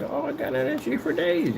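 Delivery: high-pitched mock youngster; young relatives. Mocking his Gen Z relatives' bragging, high voice. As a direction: put on a high, boastful kid voice. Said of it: "They're like".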